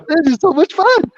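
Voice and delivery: high, bright-pitched giddy gush; giddy delight. Retold or imitated. Stoned dealers on the tour bus, high giddy gush. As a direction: gush it like a kid on a sugar high. Stoned crew laughing at chaos.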